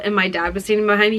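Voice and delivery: ordinary talk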